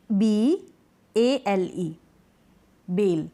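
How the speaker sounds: बी ए एल ई spelled, then बेल blended; teacher models spelling; spelling then reading. Shown as a demonstration. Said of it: "क्या पढ़ेंगे हम इसको".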